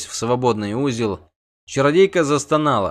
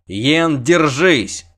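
higher, louder cry to hang on; urgent shout; 4 semitones higher, 5 dB louder, much slower than usual. A narrator's reading. Witcher to sorceress as the bridge collapses, urgent shout. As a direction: shout a desperate warning.

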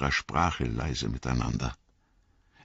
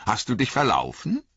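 a lightly voiced teasing elf; mock concern from an amused elf; 8 semitones higher than usual. A narrator's reading. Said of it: "rief er".

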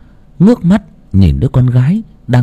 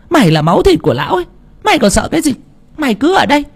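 put-on high, brisk scolding old woman; fierce old mother; 11 semitones higher, faster than usual. A narrator's reading. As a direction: scold her into staying like a fierce grandma. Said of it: "bà mẹ trợn mắt". (bà mẹ mắng con gái ở lại, giọng cao và gắt)

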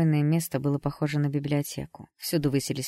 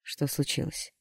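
ordinary delivery, nothing marked

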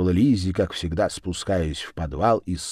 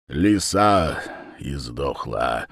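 gloomy gentleman; 6 semitones lower, slower than usual. A narrator's reading.

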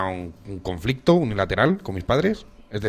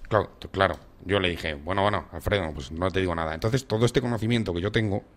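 ordinary talk, nothing marked

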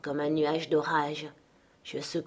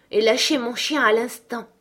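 raised, insistent order; commanding; 5 semitones higher, 5 dB louder than usual. A narrator's reading. Girl orders the servant to release her dog, commanding. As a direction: demand instant obedience. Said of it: "commanda-t-elle".

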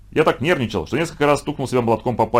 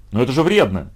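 ordinary delivery, nothing marked

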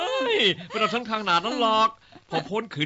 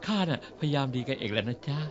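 softer, lower, mock-sweet "จ๊ะ" ending; sweetly polite; 7 semitones lower, 8 dB quieter than usual. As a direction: put on a sugary nice voice to win her over. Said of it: "พูดเพราะๆก็ได้".

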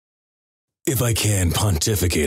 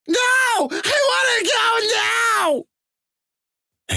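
very high squealed yell; complaining louder; much slower than usual. Acted. Girlfriend nagging a gamer to get off the computer, complaining in a shrill yell. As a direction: screech it like a whining girlfriend. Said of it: "G F starts complaining louder".

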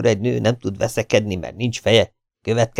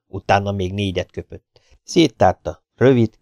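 ordinary delivery, nothing marked

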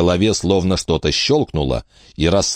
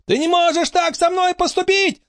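very high-pitched squeal; screamed protest. A narrator's reading. Frankie screaming at her captive, high protest. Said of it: "закричала Фрэнки".

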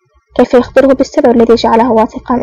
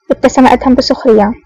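plain reading voice

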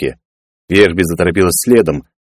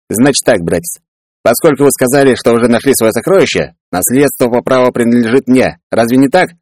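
higher-pitched, pushy character voice; scheming brother; 5 semitones higher than usual. Acted. Peller claims the inheritance from his brother, pushy and greedy.

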